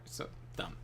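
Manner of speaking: so dumb, under the breath; breathy unvoiced aside; barely voiced mutter; whispered aside. Real, not acted.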